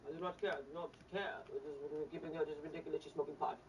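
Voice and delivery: lightly done bad Russian accent as Putin; a lazy Russian accent, low and blunt; mock Russian accent, barely put on. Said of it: "This is a very bad Russian accent".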